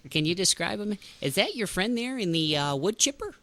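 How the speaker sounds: put-on high, loud Minnesota accent; Fargo accent. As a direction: quote Fargo in thick Minnesota twang. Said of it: "Remember that from Fargo?".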